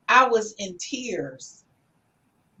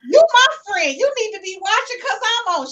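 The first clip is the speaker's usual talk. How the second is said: teasing her friend; 10 semitones higher, 5 dB louder than usual. Retold or imitated. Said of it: "my best friend since college".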